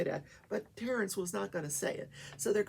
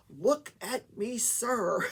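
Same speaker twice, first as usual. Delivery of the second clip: slow, stern, louder order; angry judge; 5 semitones higher, 5 dB louder, much slower than usual. Retold or imitated. Judge scolding the argumentative witness on the stand, slow and stern. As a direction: lay down the law, word by word. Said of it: "She was pissed".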